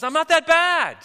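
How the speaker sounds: high, loud little creature cry; small voice crying out. Acted. Unseen maggot to a passing hiker, crying out indignantly. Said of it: "kinda small voice cry out".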